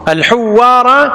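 الحوارى with a doubled, held و, slow and high; doubled waw. Shown as a demonstration. Teacher drilling the doubled waw for students, emphatic. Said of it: "بتشديد ال-، الواو".

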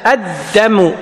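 ordinary delivery

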